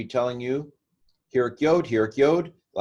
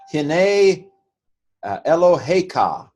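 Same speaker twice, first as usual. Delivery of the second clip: declaimed Hebrew; 7 semitones higher, 6 dB louder, slower than usual. Shown as a demonstration. Reading Hebrew verse aloud.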